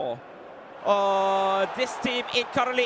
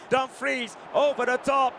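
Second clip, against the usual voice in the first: building commentary. Real, not acted.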